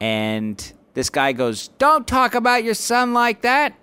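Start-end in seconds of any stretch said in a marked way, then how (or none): 1.8-3.7 high, loud put-on character voice; imitated heckler's voice. Man in the crowd objecting to comic's bit, imitated shout. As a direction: call it out high like an odd heckler. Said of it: "I hear his voice, and it sort of sounds like".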